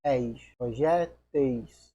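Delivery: "eis, projéteis" said slowly, "eis" stressed; slow clear ending. Shown as a demonstration. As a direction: linger on "eis" then "projéteis". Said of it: "alteração pra eis". ("eis, projéteis" bem devagar)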